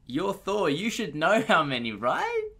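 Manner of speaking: cheeky rising right at the end; teasing. Pretend. Teasing Chris Hemsworth in an imagined reply. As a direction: needle him playfully.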